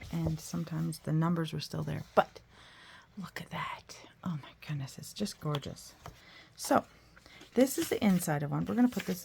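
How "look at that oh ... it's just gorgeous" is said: breathy awed whisper over pretty paper; hushed awe. Real, not acted.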